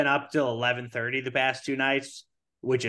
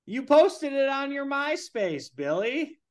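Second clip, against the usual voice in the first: very high sing-song greeting; high, stretched-out cheery caller voice; over-eager prank caller; 13 semitones higher, 4 dB louder than usual. Pretend. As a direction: pester him in a silly high voice. Pretend caller pestering Billy.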